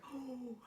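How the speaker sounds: gasp. Acted. Reacting to lightning.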